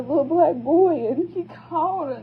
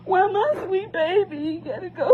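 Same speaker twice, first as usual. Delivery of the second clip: higher, pleading voice breaking into tears; tearful plea; 6 semitones higher than usual. Real, not acted. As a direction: beg God through real tears. Pleading prayer while distraught.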